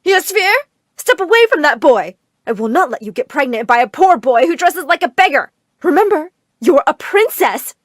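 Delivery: high, booming public scolding; loud, overwrought motherly alarm; loud announcement. A narrator's reading. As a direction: call out a stern warning to all. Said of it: "a loud announcement erupted".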